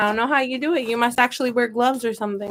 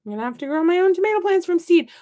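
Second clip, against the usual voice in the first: higher, quick self-quote; mock resigned realization; 7 semitones higher, faster than usual. Acts out her past resolve.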